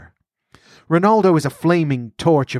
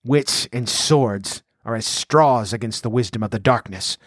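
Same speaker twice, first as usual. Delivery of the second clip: ordinary delivery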